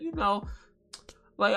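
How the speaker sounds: ordinary delivery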